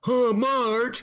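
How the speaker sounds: high, loud cartoon dad voice; a goofy put-on Homer; loud cartoon sigh of a name; Homer Simpson impression. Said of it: "That's your Homer Simpson imitation".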